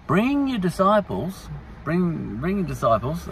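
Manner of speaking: a hint of a high-voiced official; mock Pilate summons. Acting Pilate summoning Jesus.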